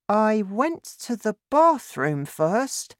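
put-on high-pitched child's voice; young son. A narrator's reading. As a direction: recount it to Dad as a small boy. Narrator voices young son George.

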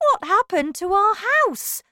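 high, loud question; shocked, high-pitched exclamation; dismayed cry. A narrator's reading. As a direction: cry out over a wrecked home. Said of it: "cried poor Mister Bucket".

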